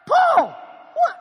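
high, loud shouted name; loud call. Acted. Philemon shouting to Martha that it's Paul. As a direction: shout the name across the house. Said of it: "let's act this thing out".

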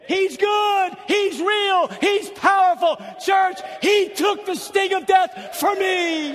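high, loud joyful shouts; happy shouting. Real, not acted. Preacher happily shouting his praise to the church, high and loud. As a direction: shout for joy, each line punched. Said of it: "I just get happy about Jesus".

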